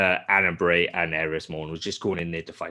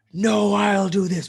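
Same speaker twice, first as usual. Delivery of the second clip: higher, slow heroic declaration; mock heroic; 12 semitones higher, 4 dB louder, slower than usual. Imitating Zavala stepping up to fight, slow mock heroic. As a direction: play the brave hero stepping up. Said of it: "Like, we rock in".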